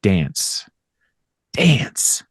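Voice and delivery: hammed-up chanted dance, whispered at the end; dramatic advice. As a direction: build the chant then drop to a whisper. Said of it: "the advice was".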